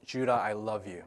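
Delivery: soft, low loving murmur; hushed, low affection; tender. Retold or imitated. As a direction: say it gently to a sleeping baby. Tender words to calmed baby.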